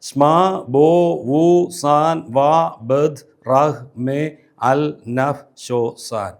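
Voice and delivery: "സ്മാ ബോ വു സാൻ" spoken as slow separate beats; syllable by syllable. Shown as a demonstration. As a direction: break "വാ ബദ് റഹ് മേ" into countable syllables. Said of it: "പന്ത്രണ്ട് syllable".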